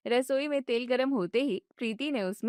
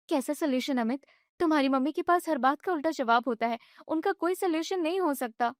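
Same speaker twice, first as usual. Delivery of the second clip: higher, fast, agitated wife; upset young wife; 4 semitones higher than usual. Acted. Wife vents to her husband about his mother, agitated and high. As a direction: vent it all at once.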